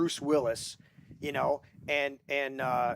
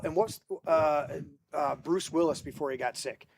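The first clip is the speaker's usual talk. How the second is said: ordinary talk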